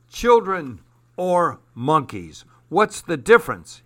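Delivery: slow then burst out in scorn; angry demand. Acted. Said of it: "he demanded".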